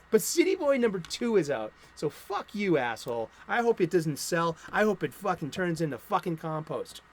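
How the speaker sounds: normal talking voice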